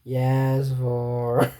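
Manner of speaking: entranced servant, metronome-like; hypnotized metronome voice. Acted. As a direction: answer like someone under a spell. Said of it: "like some kind of metronome voice".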